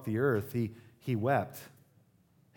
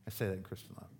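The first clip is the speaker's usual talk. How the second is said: much softer, lower repeat; soft teasing aside; 5 semitones lower, 11 dB quieter, much faster than usual. Shown as a demonstration. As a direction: let it fall to a quiet wink.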